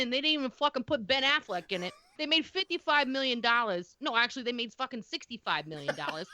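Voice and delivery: put-on light Boston accent, swearing as she reads. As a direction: reads the stats as a cranky Boston local. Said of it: "in the voice of a heavy Boston accent".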